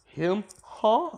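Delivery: squeaky high startled Huh; mock shock. Krillin seeing Goku appear, high startled squeak. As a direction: jump way up in disbelief.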